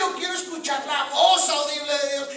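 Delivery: high, loud mock insistence; demanding churchgoer. Preacher mimicking members who skip church, loud mock demanding.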